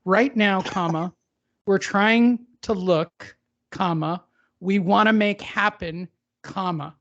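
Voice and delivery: "Right now, comma" read with every comma spoken as a word; reading commas aloud. Shown as a demonstration. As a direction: pause and say "comma" flatly after each phrase. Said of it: "did he really say it just like that".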